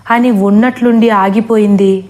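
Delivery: ordinary delivery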